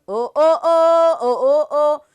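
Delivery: loud oh oh chant; mock surprise. Shown as a demonstration. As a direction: call oh at the goat. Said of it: "billy goat chant". (ਉੱਚੀ ਓ ਓ)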